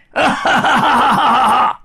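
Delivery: high cackling villain laugh; evil laugh. Acted. Demon gloating over the trap, loud wicked laugh.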